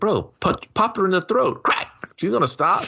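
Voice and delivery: high, shouted mock advice; mock urgent shout. Urging the movie character to punch her, high mock yell. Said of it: "And I was like".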